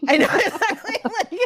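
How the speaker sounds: high laughing agreement. Real, not acted.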